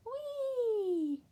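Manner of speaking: high, loud gliding whee; gleeful whee. Acted. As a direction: slide down with joy. Sledging fun.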